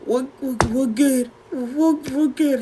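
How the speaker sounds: high, halting stammer, acted; struggling to speak. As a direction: force it out high, tripping on the first word. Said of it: "but I can't speak".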